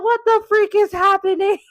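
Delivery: loud, high-pitched panicked outcry; retold freak-out. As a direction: act out a bewildered panic at full pitch. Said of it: "I'm on the phone freaking out".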